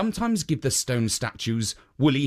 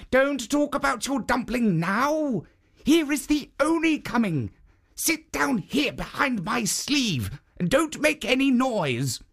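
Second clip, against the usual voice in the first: higher, hurried protective statue; raised urgent guardian voice; 11 semitones higher than usual. Acted. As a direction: hush her quickly behind the sleeve. Jitso hides the woman.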